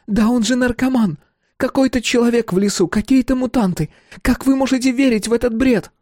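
high indignant woman yelling; furious woman. Acted. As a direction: scold them, high and loud. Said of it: "взъярилась Камила".

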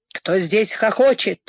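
ordinary delivery, nothing marked

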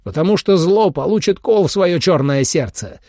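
rally the troops, weighty and proud, rising in force; rousing battle speech. A narrator's reading. Leader addresses hunters before battle, rousing speech building to roar. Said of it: "взревел он".

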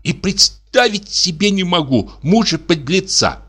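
high, indignant tirade; indignant wife. Acted. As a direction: rant in outrage. Wife indignant about scoundrel.

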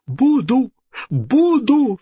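very high eager promise; desperate cry. A narrator's reading. King promising the ghost, crying out. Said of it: "вскричал король".

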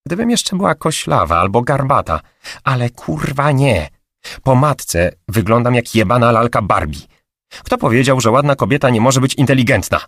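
indignant, heated venting; furious. A narrator's reading. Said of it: "Wściekała się".

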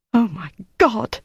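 high, horrified gasp of words; a lightly voiced shocked exclamation. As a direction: caught out and ashamed. Diana caught in the act.

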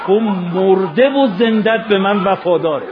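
loud, slow, drawn-out lament; impassioned cry. Acted. Preacher voices Imam's praise of loyal Abbas, impassioned cry. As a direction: proclaim it at full force, stretching the words. Preacher climax of martyrdom story.

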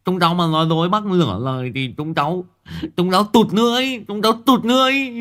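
high, fast pleading lisp rising to a squeal; lisping boy. Acted. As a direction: swear breathlessly to the old man. Lisping boy swears to Đạn. (thằng Ngọng thề thốt, giọng cao ngọng, nhanh dần)

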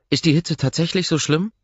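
a brisk, curious assistant; Ross's voice. Acted.

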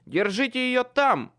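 loud, high barked order. A narrator's reading. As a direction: bark the command. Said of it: "рявкнул я".

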